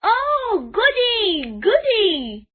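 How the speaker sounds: high, loud gleeful cry; delighted squeal. A narrator's reading. Toto-chan delighted by her lunch, high gleeful squeal. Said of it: "stop herself shouting".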